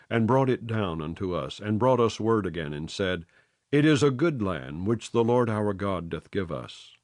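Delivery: usual speaking voice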